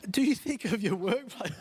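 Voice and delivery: laughing while getting the question out; cracking up. Real, not acted. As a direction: try to carry on through real laughter. Speaker cracked up at audience joke.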